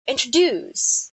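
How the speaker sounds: introduce, stressing the final -DUCE; last syllable stressed. Shown as a demonstration. Teacher repeats introduce as the example, end-stressed. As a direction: put the weight at the end of introduce. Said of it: "the last syllable is being stressed".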